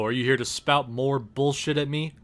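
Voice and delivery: ordinary talk, nothing marked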